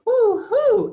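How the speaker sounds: very high, loud hoot; high storybook owl call; owl's hoot. A narrator's reading. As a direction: hoot like an owl for little kids. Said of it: "asked the owl".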